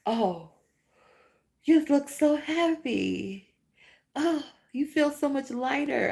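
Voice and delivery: high, slow gushing compliment; imitating people fussing over her, pitched high; mock sweet compliment. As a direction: gush like a cheerful acquaintance. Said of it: "people be telling me stuff like".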